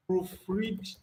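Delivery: ordinary talk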